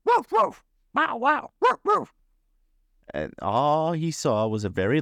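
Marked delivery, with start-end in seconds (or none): 0.0-2.1 very high yapping woofs; squeaky, sharp bow-wows; dog barking. A narrator's reading. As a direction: bark the words like an excited dog. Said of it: "all the Fraggle heard was".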